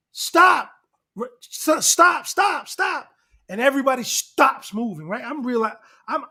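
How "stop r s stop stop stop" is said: high, loud shouts of stop, over and over; retold shout. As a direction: yell stop to halt the fight. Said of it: "all of a sudden I hear".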